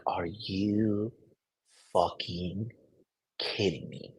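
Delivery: low, soft, deadpan disbelief; hearing the girlfriend's panic, low soft disbelief; flat disbelief. Retold or imitated. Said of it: "And I was just like".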